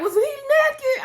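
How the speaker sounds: high, slow, shouted question; shouting. Retold or imitated. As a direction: yell it out in disbelief. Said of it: "I was in there shouting".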